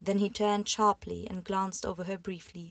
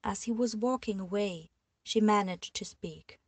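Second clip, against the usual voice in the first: usual speaking voice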